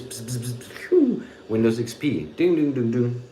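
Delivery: mock computer crash sounds, pss and ding; silly imitated Windows crash noises; brain glitch noises. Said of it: "it goes between the ears".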